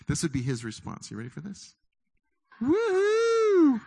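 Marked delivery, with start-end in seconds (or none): 2.6-3.8 high, loud, joyful woohoo; a triumphant squeal of delight; excited whoop. Acted. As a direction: cheer like a tiny proud ant. Ant's cheering response.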